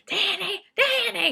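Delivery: high, strained call for Danny; mock quiet scream. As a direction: call out Danny in a shrill whispery panic. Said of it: "is quietly screaming".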